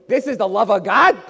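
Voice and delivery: high, loud mock disbelief; acted outraged question, thrown out hard; incredulous scoff. Satan questions God's love to Jesus, incredulous scoff.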